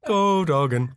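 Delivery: laughing through the nickname; real laughter while repeating it. Real, not acted. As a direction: giggle it out, barely holding it together. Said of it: "Why did you say go Doggin".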